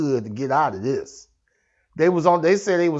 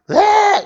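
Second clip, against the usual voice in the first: high piercing scream from a woman; woman's scream; 10 dB louder than usual. Retold or imitated. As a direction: let out a sudden high shriek of terror. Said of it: "She just kept screaming".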